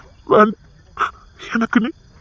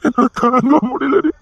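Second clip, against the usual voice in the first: anguished; 6 semitones higher, slower than usual. Acted. Father grieving for daughter.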